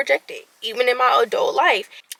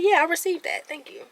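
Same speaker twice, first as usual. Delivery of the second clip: coy; 5 semitones higher, faster than usual. Retold or imitated. Receiving praise at dinner without sounding cocky, coy. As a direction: demurely take the praise, trailing into near silence. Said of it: "I was really trying to be coy".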